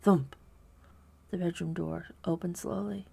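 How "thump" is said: loud, heavy thump; a sudden, forceful thump; louder thump. A narrator's reading. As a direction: punch the thud out hard. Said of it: "Louder this time".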